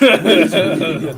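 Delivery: high, loud straining growl; over-the-top mock-heroic growl; put-on vigilante voice. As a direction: push the hero rasp until it cracks into laughter. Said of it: "Why are you talking like that?".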